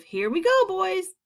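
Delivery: high, loud rallying cry; excited shout. A narrator's reading. Creatures about to go out, excited shouts. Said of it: "shouts of".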